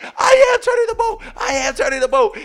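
very high, loud defensive shout; hammed-up high-pitched protest; shouting back. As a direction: imitate a frantic cousin yelling back. Donovan shouts back in quarrel.

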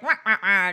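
loud cartoonish cigar-chomper noise; mocking villain wah-wah. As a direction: blurt mock gruff wah-wahs. Mimics villain with cigar in mouth.

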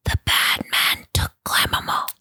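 put-on eerie child's whisper in someone's ear; hushed, voiceless storytelling whisper. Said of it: "he whispers in Fergus's ear".

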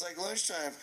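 plain reading voice

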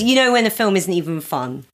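ordinary delivery

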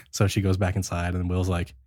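ordinary talk, nothing marked

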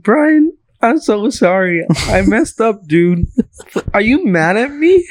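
high-pitched, whiny, tearful plea, imitated; mock drunk crying. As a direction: imitate a drunk friend apologizing through tears. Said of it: "She was crying to me".

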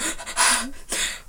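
sharp intake of breath; acted gasp. Retelling her reaction to mom's question, gasping. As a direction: gasp as if about to cry.

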